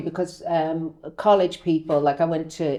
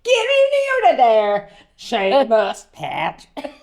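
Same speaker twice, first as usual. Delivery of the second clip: put-on high, loud Dublin child calling in; Dublin kid voice; 9 semitones higher, 6 dB louder, slower than usual. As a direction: shout them in like a Dublin kid. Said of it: "so many takes".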